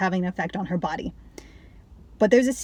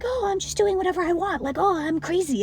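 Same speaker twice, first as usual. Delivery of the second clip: put-on high, airy carefree-girl voice, pitched way up; mocking Daisy; 10 semitones higher than usual. Mocking Daisy pretending to be carefree, high and airy. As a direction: play Daisy as a flighty ditz who doesn't care. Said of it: "being like".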